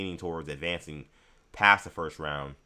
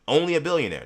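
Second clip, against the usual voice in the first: mock slogan; 10 semitones higher, 7 dB louder than usual. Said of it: "That should be his new slogan".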